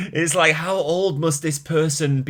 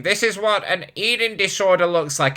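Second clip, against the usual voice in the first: higher-pitched mock accusing voice; mimicking a commenter; 5 semitones higher than usual. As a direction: put on a critic's voice, pitched up. Mimicking a critical online comment.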